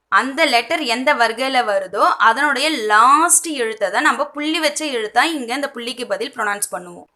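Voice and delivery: ordinary talk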